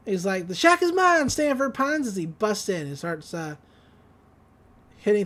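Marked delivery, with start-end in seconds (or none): 0.5-2.1 high, triumphant villain cry; Gideon. Retold or imitated. Villain bursting in on his rival, high triumphant declaration. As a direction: claim victory in a much higher voice.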